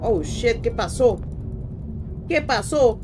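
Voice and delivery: high, loud alarmed "qué pasó"; rising panic, caught off guard; shocked outburst. Real, not acted. Sudden shock during game.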